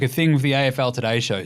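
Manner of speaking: usual speaking voice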